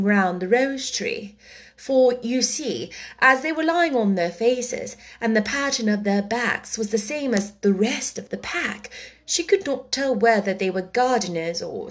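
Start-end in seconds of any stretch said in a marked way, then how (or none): none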